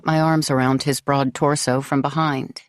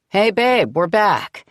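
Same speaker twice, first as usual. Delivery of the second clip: higher, louder call across house; 5 semitones higher, 4 dB louder, slower than usual. Acted. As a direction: call out from another room. Husband calling from mudroom.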